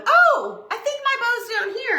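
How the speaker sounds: high-pitched, innocent "found it"; mock pleasant discovery. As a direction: act out an unsuspecting find, pitched high. Acts out looking down, unsuspecting.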